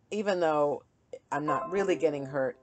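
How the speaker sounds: ordinary talk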